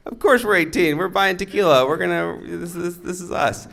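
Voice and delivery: high-pitched mock teenage bravado; overeager bluffing. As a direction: imitate a kid nervously talking his way through. Teen bluffing the tequila seller.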